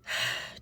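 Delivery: big acted sigh; deep steadying breath. Client on the verge of crying steadies themselves, acted deep breath. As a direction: let out a heavy breath, imitating someone holding back tears. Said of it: "deep breath".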